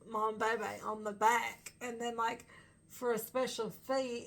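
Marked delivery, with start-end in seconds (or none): none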